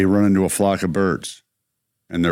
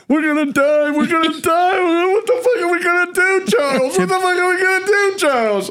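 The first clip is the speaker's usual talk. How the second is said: shrill high panicked wail, hammed up; mock freaking out, way up high in the voice; panicked Baldwin. Friend retelling a movie, imitating Baldwin panicking in a high wail. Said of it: "Baldwin's going nuts".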